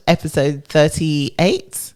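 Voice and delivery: "thirty-eight" slowed and lifted at the end, unsure; said like a question. Real, not acted. Co-host unsure of episode number, "thirty-eight" said like a question. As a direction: stretch "thirty-eight" into an uncertain question with rising tune. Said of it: "Are you asking me or telling me?".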